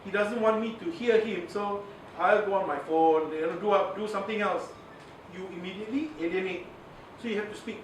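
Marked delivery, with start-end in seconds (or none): none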